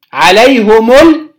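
عليهم ال- joined as "alayhumul", slow; damma before article. Shown as a demonstration. Teacher correcting for students, عليهم ال- as "alayhumul". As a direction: run عليهم into ال- with a u. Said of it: "اللي هي بالضم".

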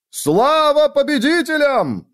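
high, drawn-out triumphant shout; yelling. A narrator's reading. Friend greets the winner entering the tavern, yelling. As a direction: holler a toast across the tavern. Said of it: "заорал Рут".

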